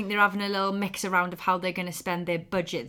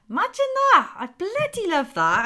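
excited; 11 semitones higher, 5 dB louder than usual. Real, not acted. Excited exclaim.

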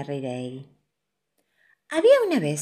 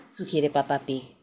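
ordinary delivery

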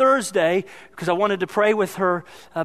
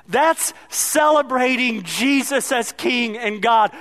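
full-force preaching; 6 semitones higher than usual. Acted. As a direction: preach it out, building to the congregation.

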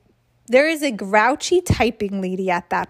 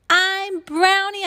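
high, drawn-out happy announcement; joyful shout; 10 semitones higher, slower than usual. A narrator's reading. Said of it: "I shouted".

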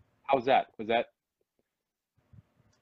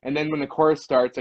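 normal talking voice

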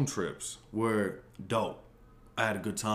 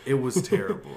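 usual speaking voice

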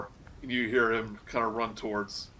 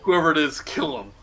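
barked order, louder and higher; cultist order; 5 semitones higher, 8 dB louder than usual. Acted. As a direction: snap the kill order across the room. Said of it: "you hear a voice go".

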